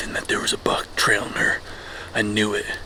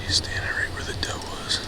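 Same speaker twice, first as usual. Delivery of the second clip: whispering; 8 semitones higher, 10 dB quieter than usual. Real, not acted. Whispering as second buck approaches.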